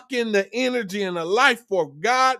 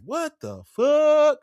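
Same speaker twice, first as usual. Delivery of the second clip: slow, higher, stretched out; drawn-out disbelief; 7 semitones higher, much slower than usual. Retold or imitated. Dumped man staring at phone, slow stunned disbelief. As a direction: let the disbelief hang, slowly. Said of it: "You like".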